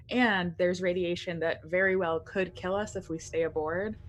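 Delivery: usual speaking voice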